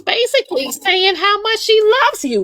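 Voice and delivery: mock outraged listener. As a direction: squeal it like a scandalised onlooker.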